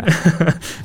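quick amused laugh; a brief real laugh; short laugh. Real, not acted. Laughs at cohost's joke.

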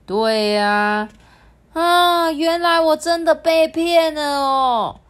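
high, loud thrilled laughing tone; delighted at being fooled; high excited voice. Acted. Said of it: "相视一笑说".

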